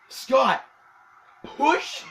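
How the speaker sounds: a sudden yelp of excitement while retelling; excited shout. Real, not acted. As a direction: shout it like the hit just landed.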